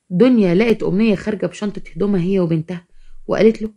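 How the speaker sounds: plain reading voice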